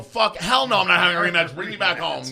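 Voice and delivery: high, loud, fed-up refusal; emphatic refusal. Retold or imitated. Telling his friend no rematch, loudly refusing. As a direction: shoot the idea down at full voice.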